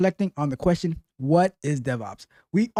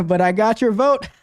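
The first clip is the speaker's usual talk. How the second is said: higher mimicking voice into a cackle; mock someone's voice, raised, ending in high laughter; put-on impersonation; 5 semitones higher than usual. Imagining her reply to voters, higher impersonation with a cackle. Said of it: "Was that a good impersonation?".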